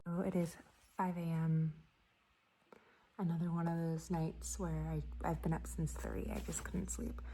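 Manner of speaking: hushed early-morning whisper. Real, not acted. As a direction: whisper so nobody wakes. Up since three, quiet.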